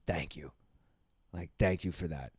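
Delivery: low, very soft imitated thanks; hushed gratitude. Imitating a woman looking at him afterwards, hushed gratitude. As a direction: quote her softly, as if deeply grateful.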